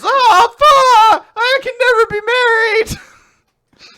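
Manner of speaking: very high, loud mock-despairing scream; hammed-up panicked howl; upset wailing. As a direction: scream it as if life is ruined. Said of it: "he actually is really upset".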